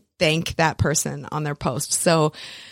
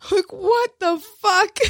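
high, slow, laughing what the fuck; shocked disbelief; 12 semitones higher than usual. Retold or imitated. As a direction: stretch it out in giddy shock. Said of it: "freaking out".

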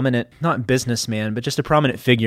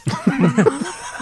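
high laugh; 14 semitones higher than usual. Real, not acted. Laughing at the quit joke.

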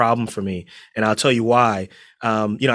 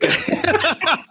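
high, bright burst of laughter; real laughter breaking out. Real, not acted. Guest laughs at intro.

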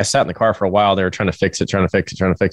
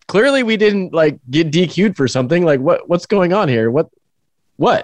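confused questioning; 7 semitones higher than usual. Retold or imitated. Self-quote, confused asking.

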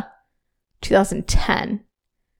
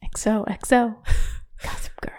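whispered, hushed sign-off with a laugh; Gossip Girl sign-off; 7 dB quieter than usual. Retold or imitated. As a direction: breathe it like a secret sign-off.

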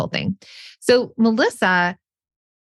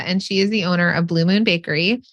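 ordinary delivery

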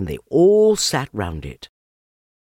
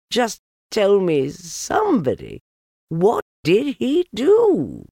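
high puzzled voice; 11 semitones higher, 5 dB louder, slower than usual. Acted. Puzzled questioner.

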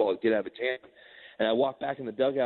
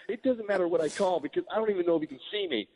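freaked out; 6 semitones higher than usual. Retold or imitated. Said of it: "he was so freaked out".

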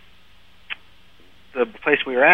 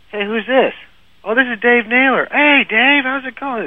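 high-pitched, surprised phone hello; mock chummy phone exchange, pitched way up; imitated phone greeting; 11 semitones higher than usual. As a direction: act out a delighted recognition on the phone. Acted phone call with old acquaintance.

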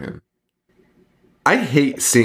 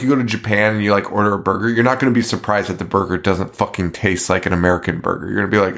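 normal talking voice

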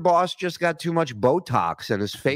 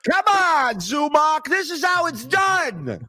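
high, slow cocky boast; show-off veteran voice, pitched high; mock cocky pro; 13 semitones higher, slower than usual. Mocking host urging a guest.

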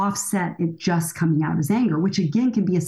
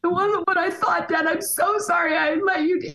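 much higher pleading daughter voice; tearful daughter; 10 semitones higher than usual. Pretend. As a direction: play the sobbing teen saying sorry. Daughter's tearful apology to dad.

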